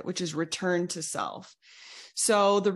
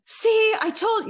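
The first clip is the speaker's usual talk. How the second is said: much higher, louder imitated comeback; mock accusing snap; 6 dB louder, slower than usual. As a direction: fire back like starting a fight, pitched way up. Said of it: "you might wanna go into fight".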